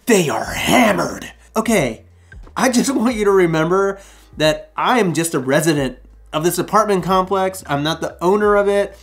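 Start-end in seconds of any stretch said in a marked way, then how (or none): 0.0-4.0 mock Karen. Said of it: "Karen comes up".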